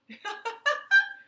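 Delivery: high, loud giggling burst; high giggle. Acted. Princess starts laughing at the stuck crowd, high giggle. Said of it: "she started to laugh".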